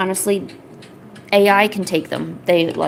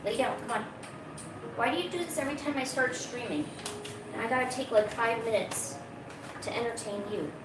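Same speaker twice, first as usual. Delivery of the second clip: softer, higher, coaxing the cat; soft pet talk; 3 semitones higher, 12 dB quieter than usual. Real, not acted. Streamer quietly telling the cat off while he climbs the chair. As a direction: hush and keep the cat calm. Talks softly to cat off mic.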